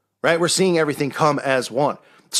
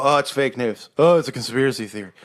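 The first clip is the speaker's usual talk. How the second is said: eye-rolling dismissal; 3 semitones lower than usual. Retold or imitated. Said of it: "they roll their eyes and they'll go".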